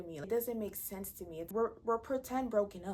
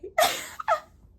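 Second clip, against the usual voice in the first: real loud cackle; loud high laugh; 12 dB louder than usual. Real, not acted. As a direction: burst of shrill laughter. Laughing at own joke.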